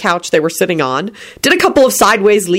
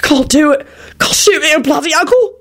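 raised pitch, horrified protest; horrified mutter; 10 semitones higher than usual. A narrator's reading. Victor staring at the wolf in horror, muttering. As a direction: protest in short, horrified bursts. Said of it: "Victor muttered as he stared".

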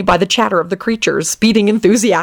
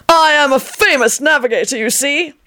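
put-on eccentric old man; 8 semitones higher than usual. A narrator's reading. Old man bragging to Lupin, high boastful voice. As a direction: puff yourself up like a dotty old sailor.

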